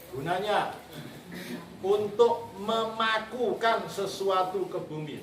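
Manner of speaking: measured teacher's dictation, word by word; slow dictation. Real, not acted. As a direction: dictate it slowly and firmly to the class. Dictating for students to write down.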